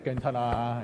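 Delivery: low, gentle reassurance; self-comforting. Shown as a demonstration. Those sitting alone telling themselves, gentle reassurance. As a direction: soothe yourself quietly. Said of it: "혼자 앉아 계시는 분 스스로한테".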